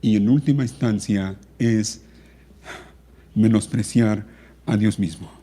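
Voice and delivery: measured and subdued pulpit voice; solemn preaching. Real, not acted. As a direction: deliver a grave, hushed warning with long pauses. Preacher gravely admonishes the congregation.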